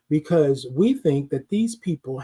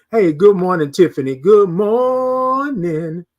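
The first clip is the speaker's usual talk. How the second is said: louder, then a very drawn-out "morning"; calling out a greeting; 5 dB louder, slower than usual. Real, not acted. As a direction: hail someone arriving, holding the final greeting long. Greets viewer joining the live stream.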